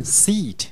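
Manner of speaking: "seat" with a long, drawn-out ee; long vowel. Shown as a demonstration. Teacher showing how seat is read, long vowel. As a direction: hold the ee in "seat" before the t. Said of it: "念成长音".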